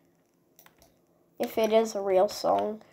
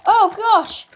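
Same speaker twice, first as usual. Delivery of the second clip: louder, high-pitched burst of alarm; alarmed cry; 7 semitones higher, 6 dB louder than usual. Real, not acted. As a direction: cry out as danger hits. Startled by danger in game.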